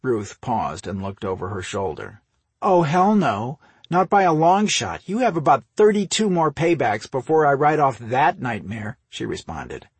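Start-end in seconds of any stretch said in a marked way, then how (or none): 2.6-9.0 high, loud, indignant comeback; emphatic refusal. A narrator's reading. Sister refusing brother's truce, indignant. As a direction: shoot it down emphatically. Sister rejects being even.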